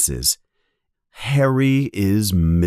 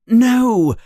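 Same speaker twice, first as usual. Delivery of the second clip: high, loud fearful cry; frightened no; 13 semitones higher, 5 dB louder than usual. Acted. Harry frightened after hearing the cold voice, high fearful cry. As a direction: recoil in fright. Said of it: "says Harry in fear".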